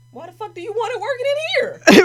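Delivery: disbelieving doctor shouting at patient; incredulous shout. Retold or imitated. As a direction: yell it in disbelief. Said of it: "And I'm like".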